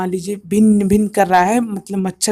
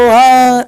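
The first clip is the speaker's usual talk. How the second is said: a loud jarring yell; harsh shout; 2 semitones higher, 6 dB louder than usual. Shown as a demonstration. Teacher demoing noise with a harsh yelled syllable. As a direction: blurt a harsh off-key shout. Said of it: "uneven सी sound".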